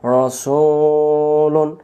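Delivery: রসুলুন with the "su" held very long on a level note; chant-like long "suuu" in রসুলুন; drawn-out long vowel. Shown as a demonstration. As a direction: stretch the middle of রসুলুন slowly. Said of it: "সিনের পেশ টানলে".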